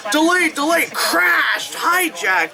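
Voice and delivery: mock alarm board; mock departure board. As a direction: blurt the alerts like a panicking sign. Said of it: "this one, it's like".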